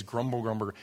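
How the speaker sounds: muttered grumbling. Shown as a demonstration. Preacher demonstrating the sound of the word grumble. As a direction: mutter it under your breath like a grumble. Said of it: "Sounds like what it means".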